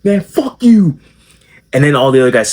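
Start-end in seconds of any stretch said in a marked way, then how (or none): 0.0-1.0 high, loud mocking insult; mock angry jock. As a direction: spit out the insult after the slap. Tall guy after slapping him.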